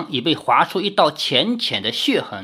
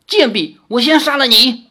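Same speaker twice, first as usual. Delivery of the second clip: louder, higher furious threat; shocked angry snap; 7 semitones higher, 5 dB louder than usual. A narrator's reading. Fox demon threatens Lin Yueru, furious. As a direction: lash out at her in rage. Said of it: "狐妖惊怒地斥道".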